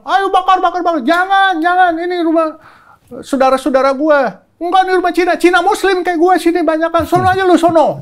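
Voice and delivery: high loud back-and-forth yelling; retold shouting. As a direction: shout over the crowd. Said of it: "Terus dia bilang". (teriak-teriak di tengah massa)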